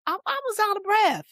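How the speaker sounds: high-pitched exclaimed confession; high, exasperated self-excuse; emphatic admission. Real, not acted. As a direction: blurt it out high, almost squeaking. Emphatic admission, then repeated more plainly.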